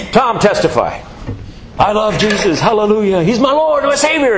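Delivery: boastful church testimony voice; proud testifier. Retold or imitated. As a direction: ham up the eager young testifier. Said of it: "I was a great testimony guy".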